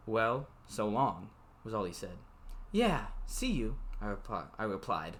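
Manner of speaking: even novel reading voice; story narration. A narrator's reading. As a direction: read the book aloud, steady. Reads novel aloud.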